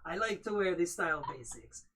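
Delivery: hammed-up French accent, not Dracula; firmly French, no vampire; back to French accent. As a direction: reset into the French gargoyle voice. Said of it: "Hold on, I'm slipping in the Dracula mode".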